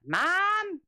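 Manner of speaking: put-on high, drawn-out kid's call; child calling mom. Son calling out to his mother from elsewhere in the house, high child's call. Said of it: "I swear I hear that".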